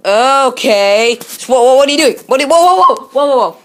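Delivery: rising surprised whoa; startled whoas. Real, not acted. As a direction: caught off guard by the dog. Dog jumps, startled.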